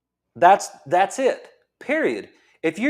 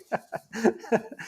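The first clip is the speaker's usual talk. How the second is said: laugh; 4 semitones higher, 6 dB quieter than usual. Real, not acted. Laughing at own outburst.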